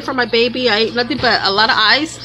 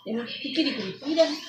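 soft cooing; 7 dB quieter than usual. Real, not acted. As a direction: mom softly cooing to the little one. Mother coos at baby.

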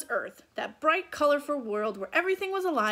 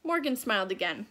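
plain reading voice

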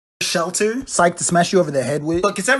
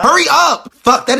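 loud; 10 semitones higher, 10 dB louder, slower than usual. Acted. Impatient yell at another sign.

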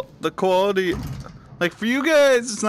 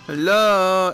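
ordinary delivery

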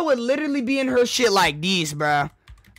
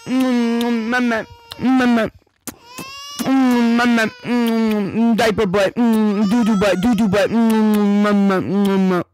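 put-on louder whimpering baby wails for mama; mock crying baby; 5 dB louder than usual. Shown as a demonstration. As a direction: bawl like a baby. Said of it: "I'm the baby".

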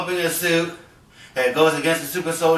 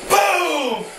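explosion sound effect; 9 semitones higher than usual. Pretend. Explosion in acted fight.

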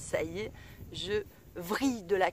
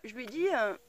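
normal talking voice

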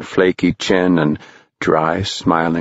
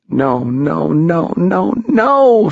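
mounting panic, building to a high cry; escalating disbelief; rising dismay; 10 semitones higher, slower than usual. A narrator's reading. Tom's horrified thought, rising.

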